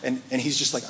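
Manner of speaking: usual speaking voice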